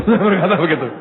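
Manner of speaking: words spilling through laughter; laughing. Real, not acted. Preacher laughing through his own punchline to the congregation, laughing. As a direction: laugh while you say it. Laughs while retelling joke.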